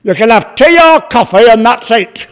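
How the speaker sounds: loud mock-gruff waitress laughing as she speaks; blunt waitress. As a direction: deflate the snob with a flat no.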